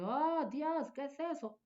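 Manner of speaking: high, startled gasp of a question; shocked exclamation. Shown as a demonstration. Woman reacts to something shocking, high and startled. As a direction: react as if you saw something awful.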